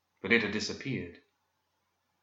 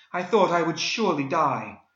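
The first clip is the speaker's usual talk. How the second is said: high, fervent rescued heroine; melodramatic damsel Daphne; 7 semitones higher, 4 dB louder than usual. A narrator's reading. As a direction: swoon like a romance heroine.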